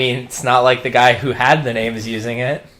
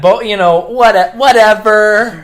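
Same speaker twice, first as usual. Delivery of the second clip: dismissive; 5 semitones higher, 4 dB louder than usual. Real, not acted. Dismissive about plot logic.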